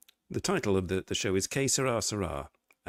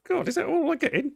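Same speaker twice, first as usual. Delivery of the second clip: mock dismay; 5 dB louder than usual. Real, not acted. Mock dismay at small pour.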